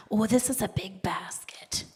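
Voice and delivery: hushed whispered aside; whispering. Real, not acted. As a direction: whisper it to yourself. Aside about a big basket.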